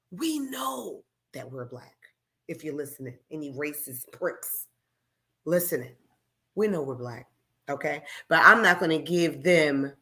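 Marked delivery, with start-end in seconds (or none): 0.1-8.0 quiet pointed address. Real, not acted. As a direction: drop to a hush to make it land. Quiet message to racist listeners.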